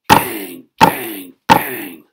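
very loud banging sound effect; loud bangs. Acted. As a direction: bang it out like heels on a wall. Heels beating the wall.

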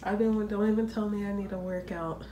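normal talking voice

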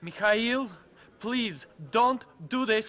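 slow, low-key pleading; restrained plea. Acted. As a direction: plead slowly, keeping the voice down. First plea to boss.

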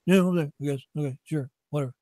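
mumbled, shrugging imitation of staff; indifferent agreement.

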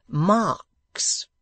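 pompous. A narrator's reading. Host explaining word.

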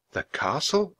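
put-on a touch of fear in a boy's question; frightened. A narrator's reading. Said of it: "said in a frightened voice".

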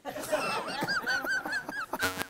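long, high peal of laughter; laughing. Real, not acted. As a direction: crack up after the punchline. Laughs at the punchline.

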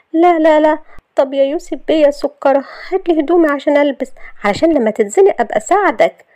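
high, loud, mock-sweet pleading; cajoling Shahd. Shahd coaxing Yousef to fetch her clothes, cajoling.

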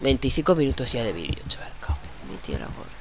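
soft, fast whispered curse; whispered grumble. Real, not acted. Gamer whispering his frustration at the long video. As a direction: whisper the complaint under your breath.

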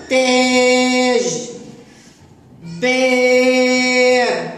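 تاج and باب, each aa held very long and loud; long drawn-out vowels. Shown as a demonstration. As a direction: stretch every aa as far as it goes. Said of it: "وينطق فتحة لأعلى طويلة".

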